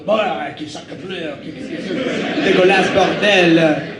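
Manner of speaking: hammed-up slow French accent; slow, theatrical French accent, gibberish French. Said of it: "their theater seats and go".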